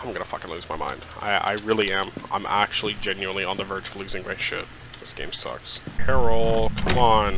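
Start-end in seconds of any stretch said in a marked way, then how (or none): 0.0-5.8 much softer, lower grumble; defeated mutter. Real, not acted. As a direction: mumble your despair to yourself.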